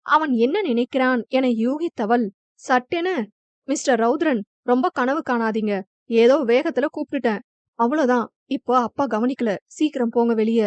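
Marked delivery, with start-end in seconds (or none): none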